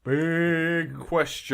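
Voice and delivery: drawn-out slow announcer voice; mock announcer. Hosts announcing the big question segment, mock announcer.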